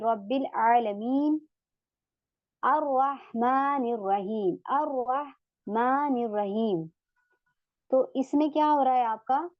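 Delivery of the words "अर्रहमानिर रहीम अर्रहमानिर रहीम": अर्रहमानिर said slowly with the r doubled and no l; silent lam. Shown as a demonstration. Teacher shows the students the silent lam. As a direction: recite अर्रहमानिर रहीम slowly. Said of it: "यहाँ पे लाम हमारा नहीं पढ़ा जा रहा".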